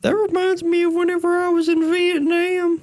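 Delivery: put-on high creaky old man voice; old grandpa voice. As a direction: play a rambling old grandpa. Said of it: "my grandpa".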